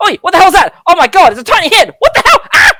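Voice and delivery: high, loud, startled shriek; alarmed shouting. Acted. Startled creature spots a tiny head reaching in, yelling high. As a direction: yell out in mock panic, high-pitched. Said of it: "You hear like".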